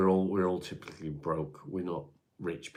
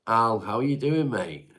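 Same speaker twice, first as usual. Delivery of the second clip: loud, high cheery hello; excited greeting; 5 semitones higher, 9 dB louder than usual. Real, not acted. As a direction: greet a friend warmly and loudly.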